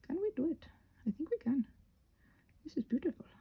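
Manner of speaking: ordinary talk, nothing marked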